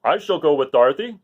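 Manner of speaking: loud, bold Lion; a big cat declaring. Acted. Lion offering to go with Dorothy. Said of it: "declared the Lion".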